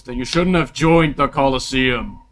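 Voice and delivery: loud, forceful retort; scolding king. Acted. Game master voicing the king rebuking a player, loud retort. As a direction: declare it like an arena ruler. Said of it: "he, he goes".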